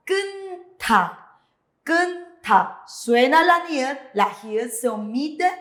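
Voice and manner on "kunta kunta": kunta in two high, separated syllables; pronunciation demo. Shown as a demonstration. Teacher shows class how kunta reads, pronunciation demo. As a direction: model "kunta" syllable by syllable, the second starting "ta". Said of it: "Sería".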